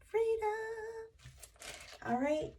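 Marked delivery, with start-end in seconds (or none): 0.1-0.5 "Freedom" flung up high, bright exclamation; high exclaimed freedom. Real, not acted. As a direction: toss "Freedom" up as a joyful echo. Echoing card meaning.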